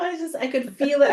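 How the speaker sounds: normal talking voice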